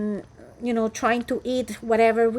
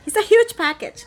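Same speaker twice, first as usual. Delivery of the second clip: excited; 10 semitones higher, 5 dB louder than usual. Real, not acted. Showing a huge package.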